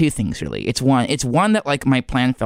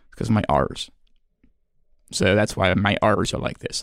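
usual speaking voice

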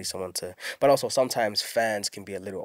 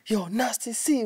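mock fan calling out; 10 semitones higher, slower than usual.

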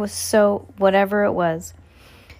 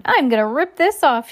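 higher, playful threat; feigned joking; 6 semitones higher, 4 dB louder than usual. A narrator's reading. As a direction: make the threat sound like a joke. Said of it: "as if she were still joking".

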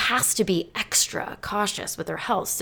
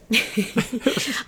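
high amused chuckle; a quick guilty laugh; short laugh; 5 semitones higher than usual. Real, not acted. Laughing about having guests.

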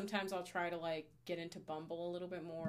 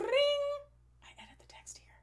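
whisper; 8 dB louder, slower than usual. Acted. Playful whispered aside.

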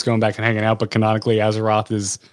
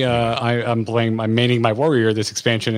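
ordinary talk, nothing marked

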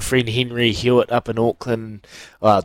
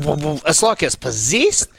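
ordinary talk, nothing marked

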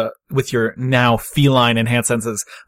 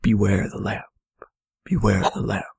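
low, hushed spirit warning; eerie whisper; 5 semitones lower, 4 dB quieter than usual. Acted. Spirit protectors warn Marie, in a whisper. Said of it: "they both whisper the exact same thing".

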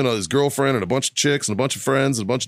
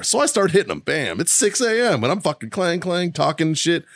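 ordinary delivery

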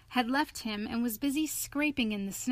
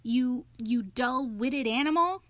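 slow, exasperated, higher pitch; impatient, rising disbelief; impatient cry; 4 semitones higher, 4 dB louder than usual. A narrator's reading. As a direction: spell it out to a dim friend, exasperated. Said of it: "cried the rat impatiently".

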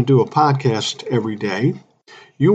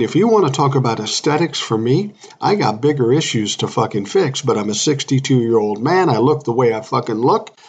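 ordinary talk, nothing marked

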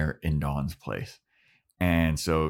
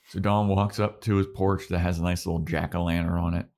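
usual speaking voice

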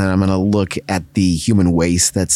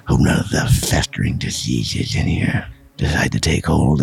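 menacing monster murmuring his name; creepy whisper; 3 semitones lower than usual. Acted. Nosferatu mocks Stan's wound.

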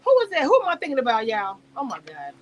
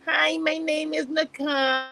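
mocking stopped-up-nose voice, slowly drawn out; Nicole; 2 semitones higher, 4 dB quieter, much slower than usual. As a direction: talk through a blocked nose, dragging out the vowels. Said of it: "What is the stopped up nose?".

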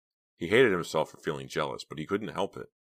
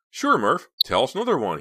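high, loud, amused comeback; chuckling; 8 semitones higher, 7 dB louder than usual. A narrator's reading. Les answering Murphy's boast about not being scared, chuckling. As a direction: scoff playfully, pitch jumping up. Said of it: "he said, chuckling".

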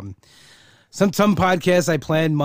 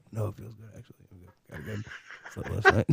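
low, soft retold mumble; imitating hushed friend; 5 semitones lower, 8 dB quieter, faster than usual. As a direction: mimic a faint, guarded phone answer. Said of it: "it was like you were afraid".

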